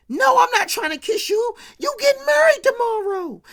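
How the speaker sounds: a loud, high no, outraged; high shouted refusal. Retold or imitated. Woman yelling at the groom not to kiss her.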